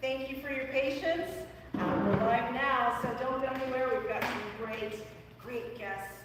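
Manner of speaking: loud, high, upbeat talk-show opener; big announcer welcome to the YouTube crowd; show host intro. Acted. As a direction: pump up the live audience like a show host. Host opens live show.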